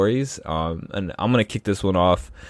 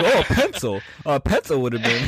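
higher-pitched clueless guesser; mock dumb answer; 5 semitones higher than usual. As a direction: play yourself as the dimwit answering. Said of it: "my dumb ass would've been like".

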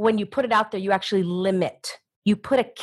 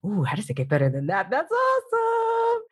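excited inner voice; 6 semitones higher than usual. Retold or imitated. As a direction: squeal it like a delighted inner voice. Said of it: "I just do it in your mind".

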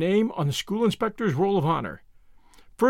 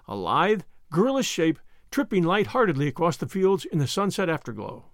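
plain reading voice

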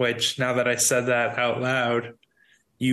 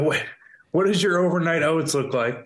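ordinary talk

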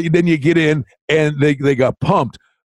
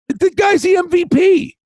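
excited insistence; 13 semitones higher than usual. Real, not acted. Insisting on his pick.

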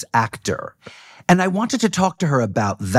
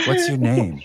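low magnetic voice; 2 semitones lower than usual. Pretend. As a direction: purr it like a phone sex caller. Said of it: "Your voice is so sexy already".

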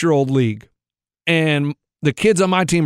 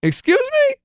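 much higher, incredulous questions; mock indignant. Retelling his reaction to the other team's trash talk, high mock indignation. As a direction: act offended at a kid's trash talk.

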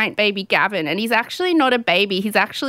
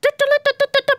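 news jingle; much faster than usual. Pretend. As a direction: belt out a newsroom fanfare. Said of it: "let's do breaking news".

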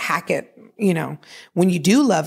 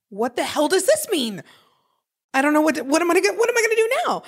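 rising panic; 10 semitones higher, faster than usual. Retold or imitated. Retold panic.

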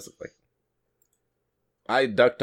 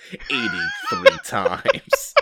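laughing; 3 dB louder, much slower than usual. Real, not acted. Cracks up mid-review.

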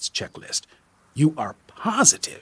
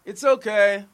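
higher, light brush-off; called over shoulder; 7 semitones higher than usual. A narrator's reading. Tourist turns to go, brushing off the guide over his shoulder. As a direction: toss it back lightly while leaving. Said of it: "he says over his shoulder".

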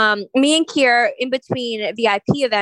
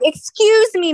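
sharp, much higher, louder "Excuse me"; indignant shop clerk; 10 semitones higher, 4 dB louder, slower than usual. Retold or imitated. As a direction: snap it like an offended clerk. Shop girl scolding Kier.

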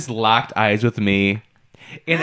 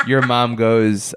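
ordinary talk

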